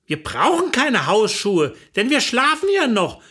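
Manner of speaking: ordinary delivery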